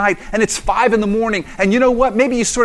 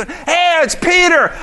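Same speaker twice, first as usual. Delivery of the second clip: excited; 12 semitones higher, 4 dB louder, slower than usual. Retold or imitated. Acted scene, knocking at the door.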